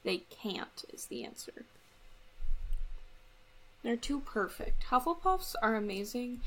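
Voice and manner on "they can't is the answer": hushed aside; whisper. Real, not acted. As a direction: whisper it as a secret. Aside, unvoiced.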